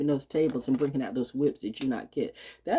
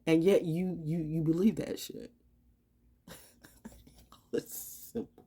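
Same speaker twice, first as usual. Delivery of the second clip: usual speaking voice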